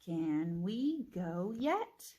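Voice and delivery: very slow, rising on yet; slow choral read with rise; slow question read. Shown as a demonstration. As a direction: stretch each word, lift yet. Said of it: "Now read it with me".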